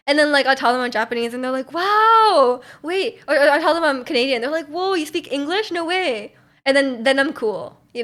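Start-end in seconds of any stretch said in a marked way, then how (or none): 1.7-3.2 high, loud drawn-out wow; exaggerated impressed gasp of words; imitated amazement. Retold amazed reaction of others. 4.7-6.3 higher-pitched astonished onlooker; imitated amazement. Retold amazed reaction of others.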